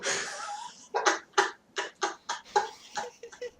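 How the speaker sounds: real helpless laughter; high shrieking laugh. Real, not acted. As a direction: laugh till it hurts. Laughing at the memory.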